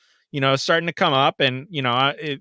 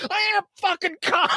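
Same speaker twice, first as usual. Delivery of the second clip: much higher, laughing angry yell; pissed denial. Retold or imitated. Driver snapping back at the lady, furious. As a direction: insist he's calm while clearly not. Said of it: "I'm fucking pissed".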